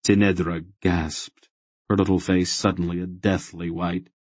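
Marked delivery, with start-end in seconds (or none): none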